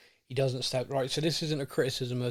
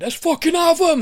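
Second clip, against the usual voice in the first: big roared rallying cry, much higher and louder; retold shout; 10 dB louder than usual. Captain in the Amazon doc roaring at his teammates, full shout. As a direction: bellow it like a captain firing up the team. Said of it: "as he shouted".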